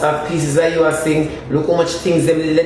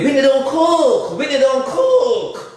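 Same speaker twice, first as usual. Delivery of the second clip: high, slow mocking voice; mock her in a high drawn-out tone; high, slow mocking impression of a woman; act like Whitney; 10 semitones higher, much slower than usual. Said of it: "act like Whitney".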